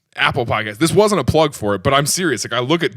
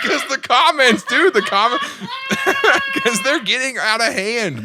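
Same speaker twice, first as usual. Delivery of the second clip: laughing; 10 semitones higher than usual. Real, not acted. Laughing at comment chaos.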